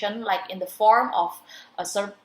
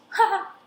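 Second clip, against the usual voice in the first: a short high burst of real laughter at the punchline; the host cracks up at the guest's dog joke, laughing; real giggle; 11 semitones higher than usual. Real, not acted. Host laughing at the guest's telegraph dog joke, real giggle. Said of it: "I'm giggling".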